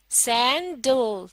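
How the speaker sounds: Sandal said very slowly, two clear beats; slow two-beat word. Shown as a demonstration. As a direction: stretch sandal into सैन and डल. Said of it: "sandal के लफ्ज में दो beats हैं". (Sandal धीरे-धीरे दो ताल में)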